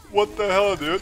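loud, high what-the-hell; excited disbelief. Real, not acted. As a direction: shout it out, amazed.

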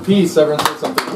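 usual speaking voice

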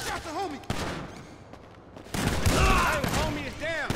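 urgent call. Acted. Yelling to the crew that the homie is shot. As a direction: call out over gunfire.

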